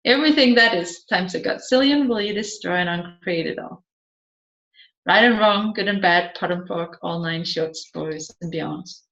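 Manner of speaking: a practised incantation, quick then trailing away; rapid clearing statement. Acted. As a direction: rattle off the formula fast, fading to a low murmur.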